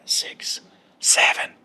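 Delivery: breathy whispered counting on the exhale; soft whisper, counting down the out-breath. Shown as a demonstration. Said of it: "That's the inhale".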